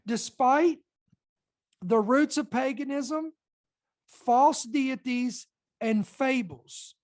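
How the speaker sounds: slow, each phrase set apart by pauses; slow and deliberate. Shown as a demonstration. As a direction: read it slowly, weighing every phrase. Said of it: "I wanna read this slow".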